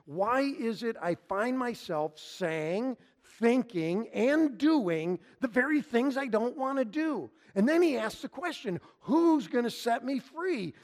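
loud, high, driving pulpit delivery; preaching at full force. Acted. Preacher crying out Paul's question to the congregation, full force. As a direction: preach it out to the back row.

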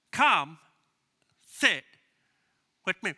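spaced out, with long pauses between; word-by-word invitation. Shown as a demonstration. Pastor teaching the congregation an invitation, word by word. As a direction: lead the room through it piece by piece. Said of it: "Why don't you say it with me".

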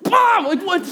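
high explosive outburst; mind-blown reaction. Retold or imitated. Dad hearing his baby laughed, mind blown. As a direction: explode in hammed-up amazement.

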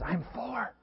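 put-on high breathy whisper of a small child. Little Jimmy whispering his name to the caller, high and hushed. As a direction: whisper your name like a hiding kid. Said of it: "And little Jimmy keeps whispering".

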